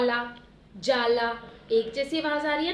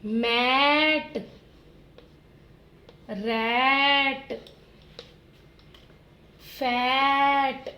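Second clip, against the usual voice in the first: each word stretched long and slow; drawn-out teaching voice; slowly drawn out; 3 dB louder, much slower than usual. Shown as a demonstration. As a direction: stretch every word out for the children. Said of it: "जैसे".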